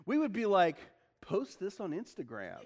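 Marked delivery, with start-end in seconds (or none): 1.2-2.6 lower, softer joking aside; mock aside. Preacher imagining us filming the demon, joking aside. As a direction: toss it off quietly for a laugh. Jokes about modern bystanders.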